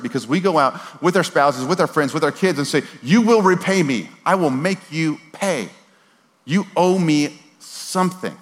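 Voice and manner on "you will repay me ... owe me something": high, slow, emphatic ultimatum; hammed-up unforgiving creditor; acted stern demand. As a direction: lay down the demand word by word. Acting out unforgiving people's demands.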